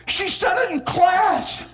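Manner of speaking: high, drawn-out accusation; scandalised. Shown as a demonstration. First grader telling on a classmate, scandalised. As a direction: point the finger with your voice. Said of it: "Point your finger".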